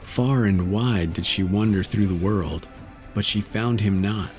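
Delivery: usual speaking voice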